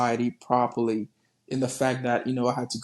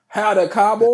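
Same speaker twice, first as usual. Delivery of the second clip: loud, higher Texan twang; Texan accent; 6 semitones higher, 8 dB louder than usual. Shown as a demonstration. Showing the accent they learned, hammed-up Texan accent. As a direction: greet in an exaggerated Texan accent, louder. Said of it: "the Texan accent".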